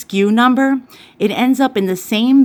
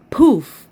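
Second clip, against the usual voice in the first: a sudden popped-out poof, higher and a bit louder; mock poof; 4 semitones higher, 4 dB louder than usual. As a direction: blurt out poof like something blowing. Phone plugged in, fuse blows.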